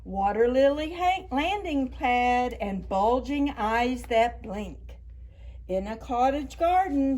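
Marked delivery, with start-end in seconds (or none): none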